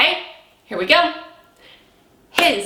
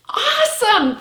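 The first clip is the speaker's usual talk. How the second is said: high excited awesome; big praise; 11 semitones higher than usual. Acted. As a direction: reward the class with a cheer.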